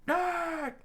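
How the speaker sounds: shrill cartoon scream, very high; high squeaky scream. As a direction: shriek it in a tiny high voice. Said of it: "the chef screamed out".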